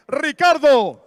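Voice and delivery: long, loud drawn-out name; ring announcer name call. Acted. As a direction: stretch the fighter's name for the arena.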